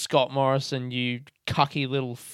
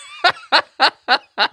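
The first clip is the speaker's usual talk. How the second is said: high laughing shriek; loud laughter burst; 12 semitones higher, 5 dB louder than usual. Real, not acted. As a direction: crack up loudly.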